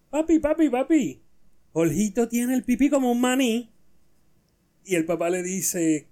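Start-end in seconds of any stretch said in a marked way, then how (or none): 0.1-3.7 put-on high, loud little-kid joke voice; high-pitched child calling out to papi; Pepito. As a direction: pipe up excitedly like a boy pestering his dad. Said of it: "Pepito le dice al papá".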